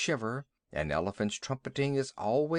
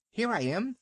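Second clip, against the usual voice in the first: much higher, yappy little terrier; yapping little dog; 9 semitones higher than usual. A narrator's reading. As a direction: bark it out like a cheeky small dog. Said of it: "yapped Vixen".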